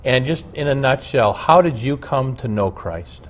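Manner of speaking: ordinary delivery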